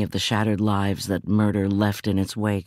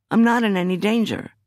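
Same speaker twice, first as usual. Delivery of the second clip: higher, sharp insistence; indignant; 11 semitones higher than usual. Acted. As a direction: brush off his concern. Arguing with the guarding cop.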